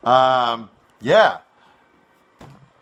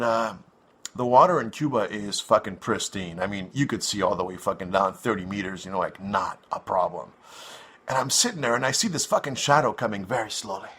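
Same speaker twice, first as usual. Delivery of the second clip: ordinary delivery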